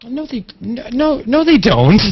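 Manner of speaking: high, squeaky playful denial; mock protest. Co-host playfully denying Star Trek rule, high mock protest. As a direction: protest it in a high, half-joking voice.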